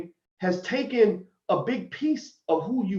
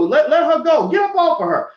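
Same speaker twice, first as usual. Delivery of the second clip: loud, high protective command; urgent intervention; 10 semitones higher, 6 dB louder than usual. Acted. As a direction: order an attacker off her, raised voice. Acted intervention to protect woman.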